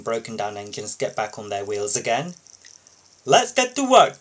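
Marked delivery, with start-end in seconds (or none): 3.2-4.2 high, loud, eager call to work; rally the team, all business; the boss calling everyone to work; busy and brisk. Acted. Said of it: "said Victor busily".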